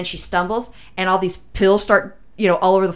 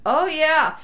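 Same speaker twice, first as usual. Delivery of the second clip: eager high agreement; 9 semitones higher than usual. Retold or imitated. Drugged mother answering eagerly.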